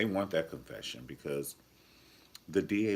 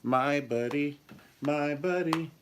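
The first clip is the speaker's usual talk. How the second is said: mock toy ad; 8 semitones higher, 3 dB louder, slower than usual. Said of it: "you be wanting to get a".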